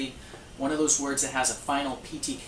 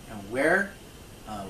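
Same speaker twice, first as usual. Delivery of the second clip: usual speaking voice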